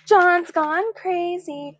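high, slow, sing-song taunt; mocking yell. Schoolboy jeering at John, slow sing-song taunt. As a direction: chant it out to jeer. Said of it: "Spider yelled".

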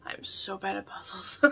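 a sheepish whispered admission; whispered confession. Shown as a demonstration. Admitting to viewers she is bad at puzzles, whispered. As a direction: whisper it, soft and secretive. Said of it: "but I am so bad at puzzles".

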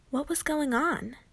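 plain reading voice

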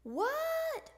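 high, drawn-out call back; hollered reply. A narrator's reading. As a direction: call back across the house. Froggy answers Dad's call.

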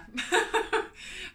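bright, high burst of laughter; real laugh. Real, not acted. Amused by a ten p price difference, laughing loudly. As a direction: laugh out loud at the oddity.

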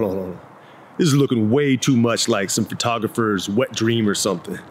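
ordinary talk

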